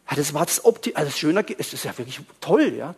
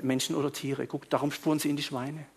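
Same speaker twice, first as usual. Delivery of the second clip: normal talking voice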